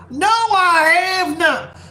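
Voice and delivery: high, slow fake Southern drawl. Shown as a demonstration. Streamer answers chat in a fake Southern drawl. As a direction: stretch it out in a hammed-up Southern accent. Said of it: "start doing my fake Southern drawl".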